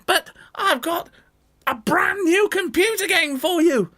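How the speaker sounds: high coaxing fake-mum voice; wolf tempting. Wolf luring the kids with a game, high coaxing voice. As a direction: dangle the bait sweetly.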